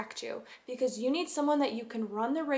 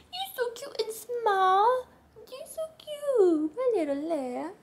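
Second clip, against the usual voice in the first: much higher, louder cooing at the dog; 10 semitones higher, 6 dB louder, slower than usual. Real, not acted. Owner coos at her dog in a high baby voice. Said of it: "my voice goes up twelve octaves".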